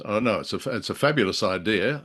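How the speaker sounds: usual speaking voice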